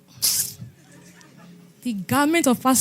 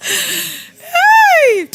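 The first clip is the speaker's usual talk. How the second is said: very high, loud cry of "Hey!"; shouted exclamation; 9 dB louder than usual. Real, not acted. Preacher cries out to the congregation, very high and loud. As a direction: cry out to the crowd.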